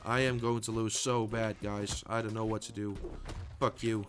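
low, quiet grumble of defeat; subdued and hopeless; resigned defeat. Real, not acted. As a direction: give up softly, sure you will lose. Resigned to losing the match.

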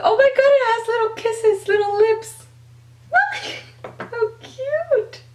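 high, gushing "so cute" squeal; excited squeal. Real, not acted. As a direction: squeal over something adorable. Squeals over lip-shaped glitter.

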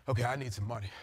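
quiet muttered prayer; muffled murmur. Retold or imitated. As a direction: say it under your breath. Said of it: "kind of just muffled voice".

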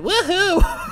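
high, playful whoop; yay cheer. Pretend. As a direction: throw out a mock celebratory cheer. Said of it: "let me do the yay emotion".

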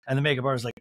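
usual speaking voice

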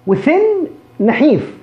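thin drawn out with a clear th; slow careful thin. Shown as a demonstration. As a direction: stretch thin, tongue between the teeth. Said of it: "شوف أنا بنطق هنا ثاء". (نطق thin ببطء مع ثاء واضحة)

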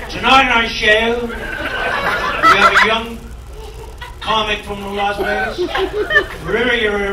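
halting TV host, stumbling over a name; Sullivan. Retold or imitated. TV host introduces a young comic, halting impression. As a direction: stiff announcer with stop-start delivery, stuck on the syllables. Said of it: "that's what Sullivan used to say".

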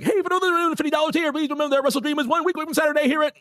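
high, rapid-fire ring announcer; Excalibur announcer voice. Retold or imitated. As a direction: rattle it off like a wrestling announcer. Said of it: "do it in the same speed as Excalibur and be like".

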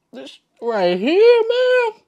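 high, loud, slow stretched praise; ecstatic. Real, not acted. Hyping the Jesus bread to viewers, slow high ecstatic praise. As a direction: build up to a squeal of delight. Peak excitement about bread.

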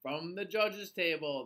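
plain reading voice

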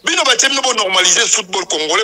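loud, forceful objection; heated rebuttal. Real, not acted. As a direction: push the argument out loudly. Heated rebuttal about normalisation committee. (koswana na mongongo ya makasi)

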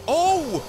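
loud, high cry of alarm; startled cry. Real, not acted. Player spotting a caiman underneath, startled cry. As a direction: cry out at sudden danger.

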